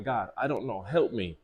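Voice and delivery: a hushed plea to God; quiet prayer. Retold or imitated. Praying for help, hushed and low. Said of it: "Let me start praying".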